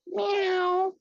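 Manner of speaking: high loud cat meow. Acted. Player meows as cat.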